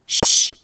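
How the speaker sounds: a quick, loud hushing sound; sharp shush. A narrator's reading. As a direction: silence everyone at once. Trot hushing the others.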